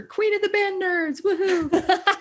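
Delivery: high, laughing mock-triumphant cheer. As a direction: crown yourself queen of the nerds with a giggle. Self-mocking cheer about band past.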